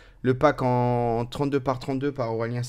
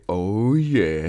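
imitated alert; 5 semitones lower, 4 dB louder than usual. Imitating the subscriber alert sound.

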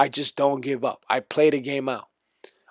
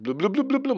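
higher, softer burst of bloop noises; imitated dribbling bloops; 9 semitones higher, 5 dB quieter than usual. Player mocking opponent's fancy dribbling, imitated bloop noises. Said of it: "the dribbling".

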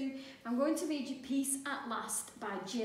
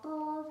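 alarm ring; 6 semitones higher, 3 dB louder than usual. A narrator's reading. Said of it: "went the alarm clock".

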